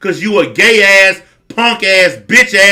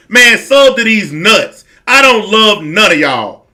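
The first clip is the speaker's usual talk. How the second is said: ordinary talk, nothing marked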